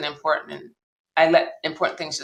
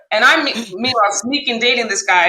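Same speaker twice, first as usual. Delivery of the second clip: ordinary talk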